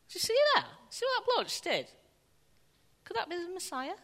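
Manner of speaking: high-pitched, hammed-up astonished bystander; amazed onlookers. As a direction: gasp it out like an astonished crowd, very high. Voicing amazed onlookers in the Gospels.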